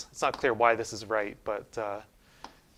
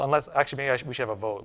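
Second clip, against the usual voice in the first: ordinary delivery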